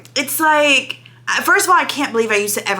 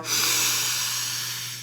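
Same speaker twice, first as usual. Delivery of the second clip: longing sigh; 8 dB quieter than usual. Retold or imitated. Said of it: "and I would just go".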